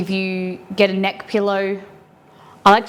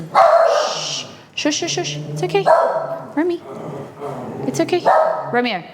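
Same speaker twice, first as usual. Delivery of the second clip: high, sweet shushing to calm the dog; soothing pet talk; 6 semitones higher than usual. Real, not acted. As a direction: coo to settle a nervous pet.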